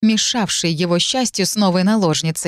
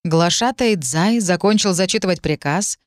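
usual speaking voice

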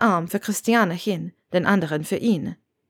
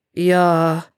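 short, mildly growled reply; a touch of a grumble; 4 dB louder than usual. A narrator's reading. Severus answers Granger's question with a growl. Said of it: "grollte Severus".

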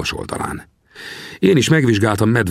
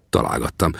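plain reading voice

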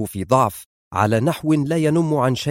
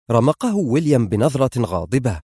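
ordinary delivery

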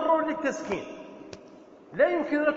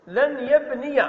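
ordinary delivery

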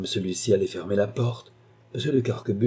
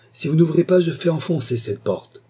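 raised, higher-pitched ultimatum; forceful threat; 8 semitones higher, 5 dB louder than usual. A narrator's reading. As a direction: threaten through a locked door. Marquis threatens to break door down.

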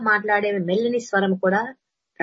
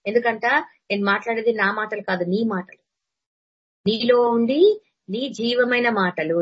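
usual speaking voice

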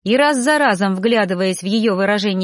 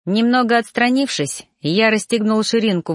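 ordinary delivery

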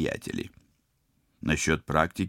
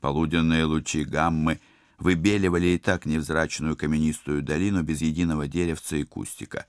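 plain reading voice

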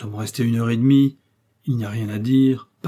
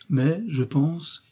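bashful; 4 semitones higher, much slower than usual. A narrator's reading. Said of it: "dit Aramis en rougissant".

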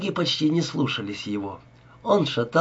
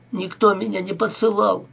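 crying protest; 7 semitones higher than usual. A narrator's reading. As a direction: cry out the denial through tears. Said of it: "плакала Зоя".

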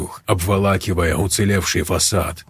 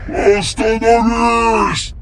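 pleading demon; 12 semitones higher, much slower than usual. A narrator's reading. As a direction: beg for mercy as a demon. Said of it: "взмолился демон". (мольба демона)

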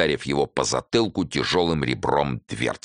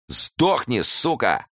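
vicious curse; 8 semitones higher, much slower than usual. A narrator's reading. Thug curses Fandorin from above the pit, vicious.

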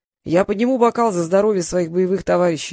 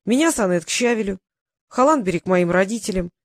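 usual speaking voice

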